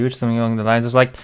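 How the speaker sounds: ordinary talk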